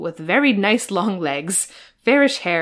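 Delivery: ordinary delivery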